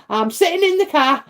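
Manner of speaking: high, whiny mock sulk; sulky tantrum. Sulking in the car in the rain, high whiny refusal. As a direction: pout it out like a stubborn child refusing. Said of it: "I'd be like".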